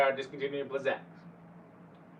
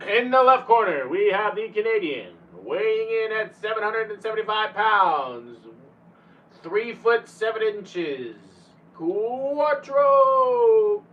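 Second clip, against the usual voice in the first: loud boxing announcer build-up, big on Quattro; ring announcer; 4 semitones higher, 5 dB louder than usual. Pretend. Streamer introducing viewer Quattro like a ring announcer. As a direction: announce him like a prizefight.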